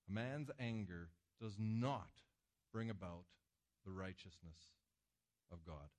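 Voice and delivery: scripture reading. Acted. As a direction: read the verse gravely, letting it die away. Reads James verse.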